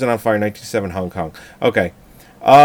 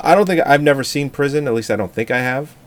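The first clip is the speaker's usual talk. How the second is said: normal talking voice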